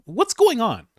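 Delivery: high incredulous yelp; mock baffled. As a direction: squeak it out in disbelief. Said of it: "you'd be like".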